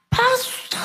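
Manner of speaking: very high mock-tearful scream; ecstatic cry. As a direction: squeal it down the phone, overjoyed. Acted ecstatic phone call after healing. (jeritan girang melengking)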